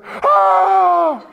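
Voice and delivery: high, loud joyful "Ah!" of surprise; thrilled father's cry; excited shout. Retold or imitated. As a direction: yelp with delight. His reaction to baby moving.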